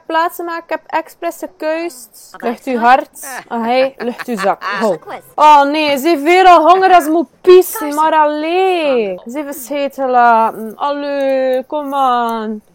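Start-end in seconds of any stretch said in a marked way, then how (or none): none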